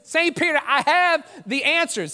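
high-pitched, slowing triumphant declaration; acted eager confidence, pitched well up; proud announcement. Boudreaux returns proudly with answers.

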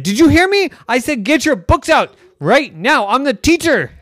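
high, loud scolding; mad teacher. Acted. Girl scolds stuffies for ignoring her, mock angry. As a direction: demand they obey now.

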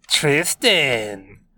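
lilting teasing sing-song. A narrator's reading. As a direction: sing his name teasingly. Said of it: "Shin said in a sing-song voice".

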